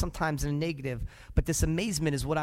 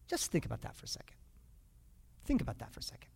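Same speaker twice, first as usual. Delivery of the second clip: much softer, hushed whisper; 7 semitones lower, 12 dB quieter, faster than usual. Acted. Preacher hushing the congregation to reflect, whispered. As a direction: drop to a whisper so they lean in.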